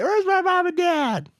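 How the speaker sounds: high, slow mock wail; hammed-up high child's cry for parents; imitated distraught kid. Imitates distraught kid collapsed on stairs.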